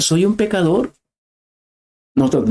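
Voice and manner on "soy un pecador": higher, mock shout of confession; imitated cry. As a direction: cry it out as a sinner on the floor. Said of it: "tirarse al suelo y gritar".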